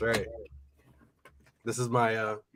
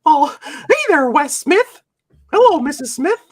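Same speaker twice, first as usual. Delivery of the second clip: high, louder cartoon mouse greeting; high cartoon mouse hello; Mickey Mouse voice; 11 semitones higher, 7 dB louder than usual. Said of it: "do the Mickey Mouse voice".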